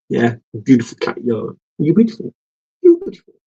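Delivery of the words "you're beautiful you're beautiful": cooing at cat. Real, not acted.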